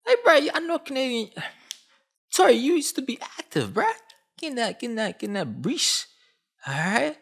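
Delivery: high, excited Toronto slang voice; Canadian street slang. Acted. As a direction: talk like a guy from the six. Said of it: "I gotta talk to you as a Canadian".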